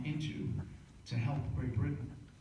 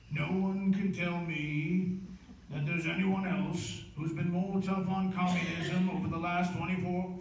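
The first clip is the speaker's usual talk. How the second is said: put-on Churchill accent; 3 semitones higher than usual. Host doing his Churchill accent for the audience. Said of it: "I don't get to do the accent on the show that much".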